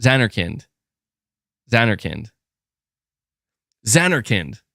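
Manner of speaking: Zanarkand as ZAN-er-kind, repeated evenly; repeated "Zanarkand", stressed on ZAN; practicing pronunciation. Shown as a demonstration. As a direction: drill Zanarkand with a reduced middle, ZAN-er-kind. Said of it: "say it over and over again".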